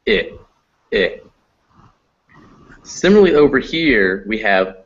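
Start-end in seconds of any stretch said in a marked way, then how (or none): 0.1-1.2 "I" as a short lax ih; relaxed short I. Shown as a demonstration. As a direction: release the muscles into "I" as in bit. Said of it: "you relax all those muscles".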